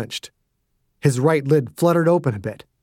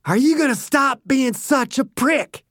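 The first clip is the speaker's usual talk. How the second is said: higher, sneering fury; angry taunt; 10 semitones higher than usual. Acted. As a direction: jeer at him as you swing. Dew taunting beaten man.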